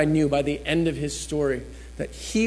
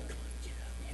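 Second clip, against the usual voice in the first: hushed, voiceless nudge; very soft secret prompting; whispered urging; 18 dB quieter than usual. Retold or imitated. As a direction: whisper it as if from behind. Retells voice he mistook for neighbour.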